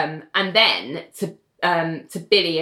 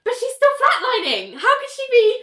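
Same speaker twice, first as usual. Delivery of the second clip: high, incredulous cry of disbelief; astonished doctor. Retold or imitated. The doctor gasps as the flatlining patient wakes, astonished. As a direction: squeak it out in total disbelief.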